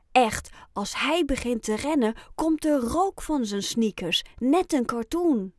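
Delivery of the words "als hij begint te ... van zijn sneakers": ordinary delivery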